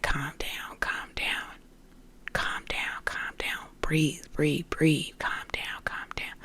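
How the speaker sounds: soft, breathy whisper; hushed calming voices. Retold or imitated. Inner voices soothing a panicking woman, hushed whisper.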